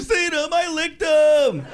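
high, ranting sitcom villain; hammed-up high-pitched accusing tirade, building to a shout; Newman. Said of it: "he took the Newman character".

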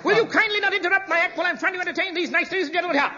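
indignant. Acted. Interrupting actor, indignant.

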